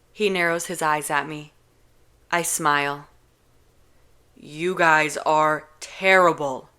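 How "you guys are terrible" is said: slow, louder grossed-out verdict; slow, loud mock disgust. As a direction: pull a face while saying it. Kenji disgusted by romance.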